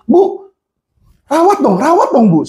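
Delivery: high, pressing request to the nurse; urgent plea. Retold or imitated. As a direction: insist the nurse treat him now. Urging the nurse to treat patient. (mendesak suster dengan nada tinggi)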